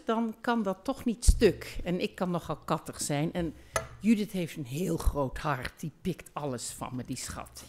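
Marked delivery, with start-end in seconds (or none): 5.8-7.6 fond whisper. Real, not acted. Fond remark about her friend.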